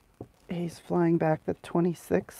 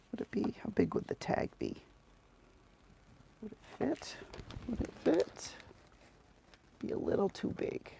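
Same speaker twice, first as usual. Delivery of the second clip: soft, half-whispered to herself; muttering; 4 semitones higher, 10 dB quieter than usual. Real, not acted. Thinking aloud over the tag size, half whispered. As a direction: mutter it privately.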